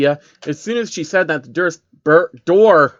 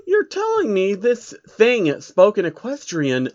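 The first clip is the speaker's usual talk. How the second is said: higher, slow lady's voice; feminine voice; 6 semitones higher than usual. Acted. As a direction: ask it doubtfully, in a higher feminine pitch. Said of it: "a feminine voice spoke".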